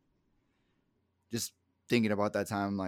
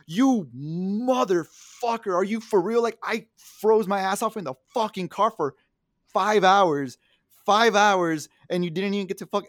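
louder, higher furious rant; angry yelling; 8 semitones higher, 8 dB louder than usual. Retold or imitated. On the phone to Arnold after freezing, furious yelling. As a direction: blow up at him in disbelief.

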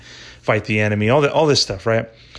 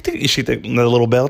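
ordinary delivery

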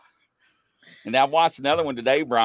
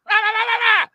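high shrill-free yelled rah, much higher and louder; imitated scream; 6 dB louder than usual. Dad screaming into drive-thru speaker, high mock yell. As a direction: scream nonsense into the speaker like a tic. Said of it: "he's screaming in the speaker".

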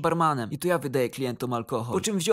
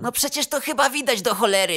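higher, exasperated angry retort; pissed-off manager; 6 semitones higher than usual. A narrator's reading. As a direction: insist it's obvious, voice raised. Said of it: "Kierownik był równie wkurwiony". (wkurzony, podniesiony głos)

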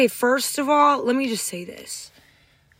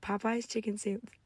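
hushed confession; 12 dB quieter than usual. Real, not acted. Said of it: "I've never said this before publicly".

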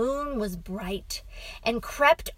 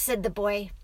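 ordinary delivery

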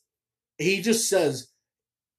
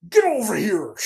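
higher, loud shouted catchphrase; Scorpion yell; 5 semitones higher, 5 dB louder than usual. Retold or imitated. Reviewer tries the catchphrase again, a loud shout. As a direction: throw out the catchphrase as a battle cry. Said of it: "You know, or".

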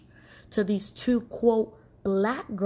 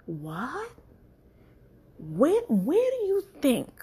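disbelief; 6 semitones higher, slower than usual. Acted. Incredulous reaction to flight date.

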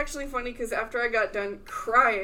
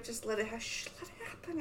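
self-soothing hush; 13 dB quieter than usual. Retold or imitated. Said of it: "I was just like".

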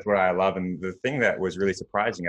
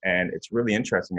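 ordinary delivery

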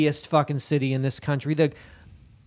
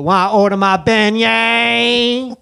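loud, high, drawn-out New Orleans holler; exaggerated slow New Orleans accent; put-on New Orleans accent; 6 semitones higher, 6 dB louder, slower than usual. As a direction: stretch it out like a hammed-up New Orleans local. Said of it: "think I'm a local".

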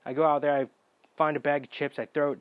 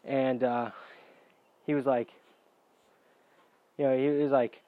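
usual speaking voice

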